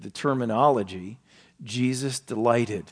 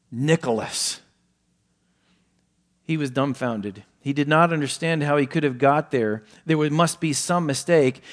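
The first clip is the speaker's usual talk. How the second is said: preacher telling a story, rising and louder; story narration; 3 semitones higher, 5 dB louder than usual. Acted. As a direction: tell the tale with growing drama. Sermon illustration story read.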